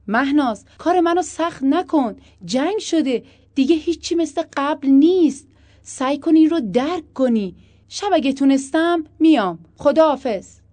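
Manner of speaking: loud, high-pitched insistence; pressing, loud appeal to accept it; exasperated urging. A narrator's reading. Husband argues over the phone.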